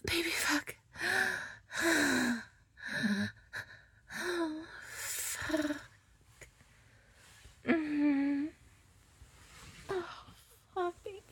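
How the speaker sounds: very soft, high, hushed gasps; spent afterglow. Acted. Just after climax, murmuring softly to her lover. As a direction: come down, breathless and hushed.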